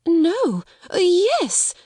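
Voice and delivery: high, anxious faltering; flustered. A narrator's reading. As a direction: stumble nervously over the answer. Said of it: "Miss Amelia began to turn pale".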